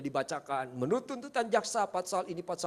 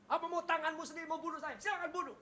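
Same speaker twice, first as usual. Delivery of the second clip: high, soft, fast, bitter dare; bitter taunt; 9 semitones higher, 6 dB quieter, faster than usual. Retold or imitated. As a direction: sneer "go ahead, kill me". Bitter taunt to his brother.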